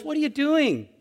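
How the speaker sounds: high-pitched, reproving question; harsh challenge. Acted. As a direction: challenge them as if catching them out. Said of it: "God is judging us harshly".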